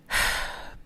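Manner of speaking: long, soft exhaled sigh; relaxing sigh. Pretend. Host shows listeners a moment to unwind, relaxing sigh. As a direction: let out a slow breath to unwind.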